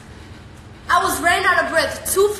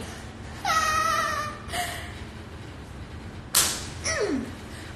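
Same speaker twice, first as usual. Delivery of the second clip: a squealing burst of laughter; high squealing laugh; 13 semitones higher, 6 dB quieter than usual. Real, not acted.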